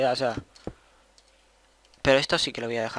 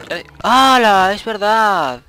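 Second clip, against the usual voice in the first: a high, loud, drawn-out hala of dismay; loud surprise; 7 semitones higher, 13 dB louder, much slower than usual. Real, not acted. Gamer realizing he lost his eggs, loud surprised cry. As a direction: shout out the sudden realization.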